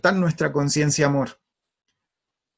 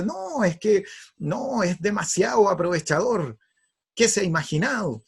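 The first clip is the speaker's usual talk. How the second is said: acted indignant protest, higher pitch; angry father; 6 semitones higher than usual. Father protesting to his wife about the son, angry. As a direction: keep grumbling as the outraged dad. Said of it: "y se empieza a enojar".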